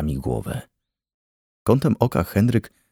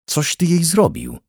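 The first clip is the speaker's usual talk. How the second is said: howled; 10 semitones higher than usual. A narrator's reading. Father turns on Henryk over his daughter, howling. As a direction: wail the accusation with pitch leaping up. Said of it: "zawył".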